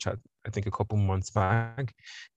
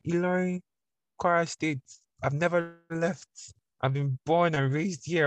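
a bit higher, slow insistent reply; patient higher-pitched answer; lightly raised self-quote; 9 semitones higher than usual. Retold or imitated. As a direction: lay out the facts slowly.

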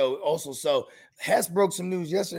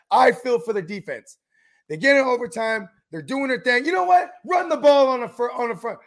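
mock rant; 5 semitones higher, 5 dB louder than usual. Retold athlete ranting on TV.

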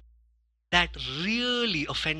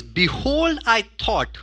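mock indignant Naaman; 4 semitones higher, 4 dB louder, slower than usual. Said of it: "It says".

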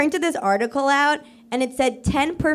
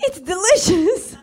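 slow, high, laughing mock praise; fake praise; 7 semitones higher, slower than usual. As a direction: gush over the stew while laughing. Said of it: "And I was like".

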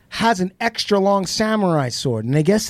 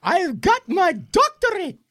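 much higher, put-on proud tone; proud daughter voice; 12 semitones higher than usual. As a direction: show off the doctorate in a high sing-song. Daughter proudly shows PhD to dad.

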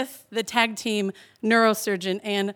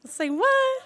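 much higher, stunned squeak; shocked. Retold or imitated. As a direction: blurt it in disbelief. Reacting to skull surgery plan.

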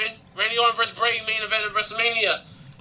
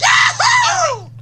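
very high whooping cheer; excited yell. Real, not acted. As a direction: whoop it out with delight. Cheering at start of predictions.

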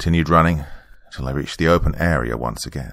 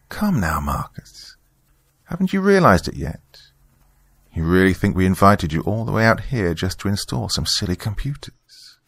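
high-pitched, condescending villainess; Margaret taunting Marcus; 3 semitones higher than usual. Acted. Church member confronting her cornered guest, taunting in a raised voice. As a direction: lift the pitch into a knowing, taunting woman's voice.

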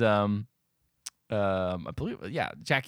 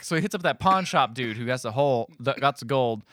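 ordinary talk

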